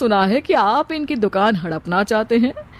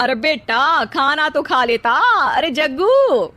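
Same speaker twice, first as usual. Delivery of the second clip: higher, slow call after someone leaving; 4 semitones higher than usual. Acted. The mother calls after the fleeing Jaggu, slow raised call. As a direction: draw out the name as he runs off.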